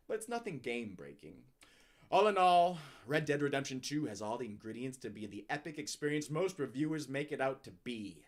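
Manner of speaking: normal talking voice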